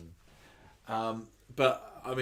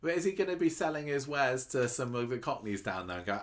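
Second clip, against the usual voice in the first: normal talking voice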